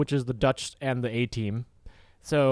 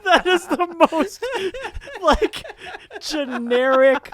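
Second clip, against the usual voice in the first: high, loud words through real laughter; slow, laughter-choked squeal; laughing hard; 13 semitones higher, 7 dB louder, slower than usual. Real, not acted. Podcaster cracking up at the generic movie line, laughing hard.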